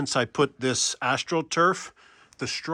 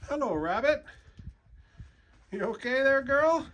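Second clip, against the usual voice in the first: pet-talk voice; 10 semitones higher than usual. Real, not acted. Cooing to the rabbit.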